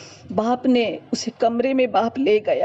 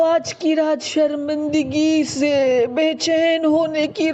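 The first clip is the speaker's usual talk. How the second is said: tearful preaching; 4 semitones higher than usual. Real, not acted. Preacher to mourners, tearfully pleading. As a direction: voice thick with tears, pleading.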